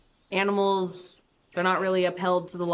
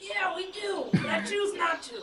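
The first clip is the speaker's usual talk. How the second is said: shrill laughing shout up high; high squealing pig; 12 semitones higher, 4 dB quieter than usual. Acted. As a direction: squeal it out like a rowdy hog.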